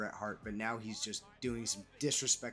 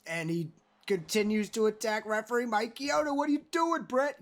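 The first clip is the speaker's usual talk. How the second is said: much higher and louder, an incredulous yell at Bret; shocked outcry; 8 semitones higher, 5 dB louder than usual. Real, not acted. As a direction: shout it in disbelief as the wrestler attacks the ref. Commentator outraged at ref attack.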